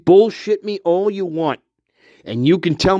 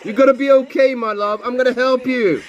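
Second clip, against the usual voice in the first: weird tone; 7 semitones higher, faster than usual. Retold or imitated. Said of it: "Like a weird tone".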